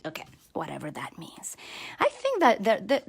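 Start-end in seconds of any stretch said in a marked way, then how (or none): 0.0-1.6 whispered aside. Real, not acted. Aside after joke.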